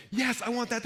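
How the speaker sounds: high, eager yes to the menu; eager craving. Retold or imitated. Emotional monkey greets the menu with high, eager craving. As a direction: grab it like a hungry kid. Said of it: "your emotional monkey's like".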